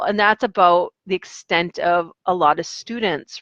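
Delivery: normal talking voice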